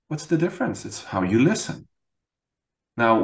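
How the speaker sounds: ordinary talk, nothing marked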